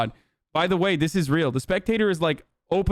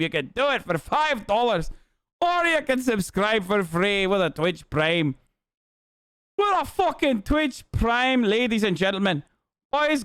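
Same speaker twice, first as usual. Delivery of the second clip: hype announcer plug; 4 semitones higher than usual. Acted. Streamer pitching subs to chat, announcer hype. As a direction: sell it like a ringside announcer.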